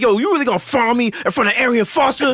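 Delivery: mock shout. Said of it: "and I'm like".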